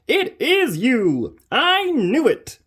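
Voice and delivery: slow, high, loud yell; high slow triumphant yell. Acted. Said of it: "yells the train driver".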